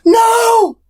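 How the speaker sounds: sharp high gasping no; dismayed gasp. Real, not acted. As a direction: gasp and cry out. Reacts to something falling.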